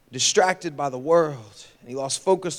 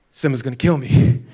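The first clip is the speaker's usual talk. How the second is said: usual speaking voice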